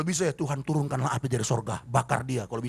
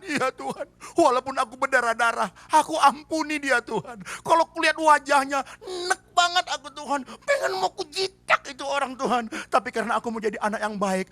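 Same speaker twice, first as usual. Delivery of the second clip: pained prayer; 6 semitones higher than usual. Acted. Preacher acts a pained prayer.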